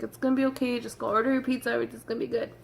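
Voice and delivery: soft, imitated reassurance; quietly calm someone down, as a retold quote; soothing. Partner telling her to go order pizza, soothing.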